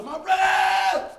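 loud, high, drawn-out holler. Retold or imitated. Preacher recalling how he greeted brothers, hollering slowly. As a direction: call it out slowly at full voice. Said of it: "I used to holler".

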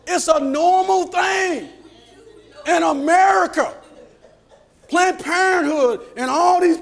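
high, loud, sustained pulpit cry; full-force preaching. Real, not acted. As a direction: shout it out to the congregation as urgent exhortation.

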